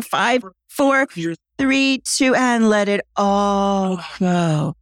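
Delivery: rising count, then slow let go; countdown release. Shown as a demonstration. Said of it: "countdown then release".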